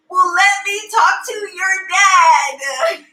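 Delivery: high, slow singsong mom voice; hammed-up falsely cheery mother; forced cheer. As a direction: cover alarm with stretched-out sweetness. Mother covering alarm with cheer.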